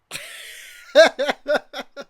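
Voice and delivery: a big burst of laughter; a real hearty laugh; loud laugh. Real, not acted. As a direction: crack up out loud. Laughs at metal hand joke.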